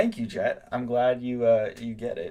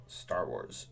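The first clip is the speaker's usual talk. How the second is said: deep dramatic title; 7 semitones lower, 5 dB quieter than usual. Shown as a demonstration. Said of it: "I call this one".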